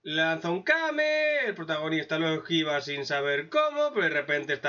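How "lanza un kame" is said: usual speaking voice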